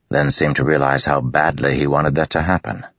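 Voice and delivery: ordinary delivery, nothing marked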